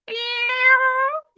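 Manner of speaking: very high, rising squeak of a hello; tiny cartoon voice saying hello; squeaky hello. Greeting the listeners in voices.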